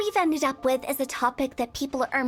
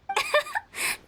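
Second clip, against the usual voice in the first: high teasing giggle; playful giggle. Real, not acted. Hu Tao laughs off creeping out Paimon, teasing giggle. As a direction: giggle at having spooked her.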